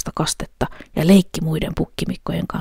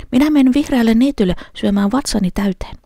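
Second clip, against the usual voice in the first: little goat; 6 semitones higher than usual. A narrator's reading. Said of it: "sanoi pukki".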